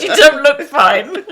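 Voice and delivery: slow, high, loud, laughing retort. Retold or imitated. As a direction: drag it out through laughter. Boss retorts.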